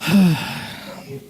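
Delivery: a long, heavy put-on sigh; show-off impatient sigh; exasperated sigh. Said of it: "I start to do the whole".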